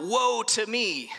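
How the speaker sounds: understated retold scream of doom; mild mock scream. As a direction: cry it out slowly, without a full scream. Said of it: "he screams out".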